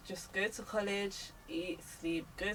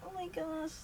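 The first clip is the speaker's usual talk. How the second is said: tag gushy mock exclamation; 8 semitones higher, 5 dB quieter than usual. Acting out happy snaps.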